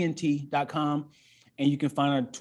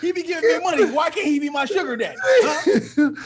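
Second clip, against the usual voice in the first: higher and louder, cracking up; laughing joke; 11 semitones higher, 7 dB louder than usual. Real, not acted. Host jokes about God as sugar daddy, laughing. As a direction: tease it out through laughter.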